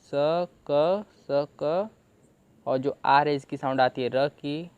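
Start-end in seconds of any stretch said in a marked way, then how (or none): 0.0-1.9 स क drawn out very slowly as separate sounds; slow phonics for स क; sounding out slowly. Shown as a demonstration. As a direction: stretch क, then स क. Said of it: "इसे हम क पढ़ रहे हैं".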